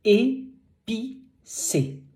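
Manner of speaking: high, very slow model of épicé; slow French demo. Shown as a demonstration. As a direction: draw out épicé for the learner. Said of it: "like it's".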